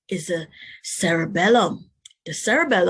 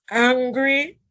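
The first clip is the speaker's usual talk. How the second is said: acted angry; 4 semitones higher, 6 dB louder than usual. Reading emotion words with expression.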